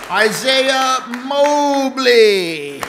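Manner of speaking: loud, slow, drawn-out name call; radio announcer voice. Pretend. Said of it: "sound like my best radio announcer's voice".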